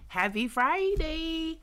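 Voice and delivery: high, loud, slow sing-song; cheery greeting. Real, not acted. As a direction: sing it out to the viewers. Greeting viewers brightly.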